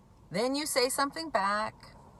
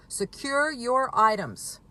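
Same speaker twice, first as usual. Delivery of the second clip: louder, urgent, slowed-down orders; urgent warning; 4 semitones higher, 6 dB louder than usual. Retold or imitated. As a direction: bark out a retold alarm to a worried mother. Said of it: "I told her".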